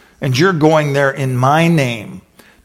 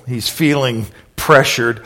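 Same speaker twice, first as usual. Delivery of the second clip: ordinary talk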